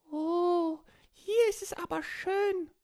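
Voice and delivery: high squeaky little creature voice; small child voice. Acted. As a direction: speak like a tiny excited child. Borki and dolphin on island.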